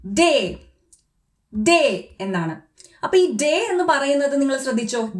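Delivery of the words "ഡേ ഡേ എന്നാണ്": ഡേ said as a long day; German letter name. Shown as a demonstration. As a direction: model ഡേ slowly. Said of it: "ഡി എന്നുള്ള ജർമ്മനില്". (German ഡേ ഉച്ചാരണം)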